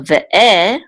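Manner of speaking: Ve'e with the final e stressed; Ve'e, weight on its second syllable. Shown as a demonstration. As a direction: lean on the last e of Ve'e. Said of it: "this is the accent of the word".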